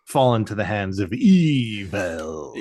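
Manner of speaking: drawn-out, hammy evil; playfully ominous and very slow; mock-ominous. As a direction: stretch it out like a melodrama. Mock-dramatic villain phrase in retelling.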